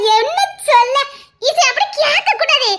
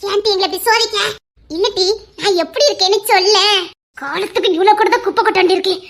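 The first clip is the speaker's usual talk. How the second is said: ordinary talk, nothing marked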